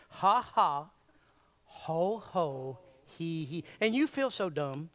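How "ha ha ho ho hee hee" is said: timid, unsure ha ha ho ho hee hee; half-hearted laugh exercise. Shown as a demonstration. Preacher has the crowd try a timid laugh. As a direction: try the laugh like you feel dumb.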